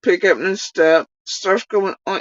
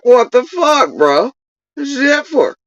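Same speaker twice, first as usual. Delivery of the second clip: higher-pitched, puzzled protest; indignant deer; 7 semitones higher than usual. Acted. Deer objects to the music being turned down, mildly indignant. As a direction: mildly put out, voicing the deer.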